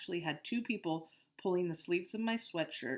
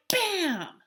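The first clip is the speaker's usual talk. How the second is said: excited; 12 dB louder than usual. Retold or imitated. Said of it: "And you were like".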